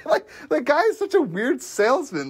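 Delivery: amused, giggling as you speak; laughing. Real, not acted. Laughing about the weird gun salesman, giggling.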